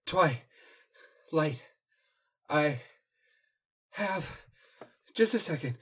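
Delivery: gasping between words; panting, broken up words; out of breath. Acted. Spike out of breath.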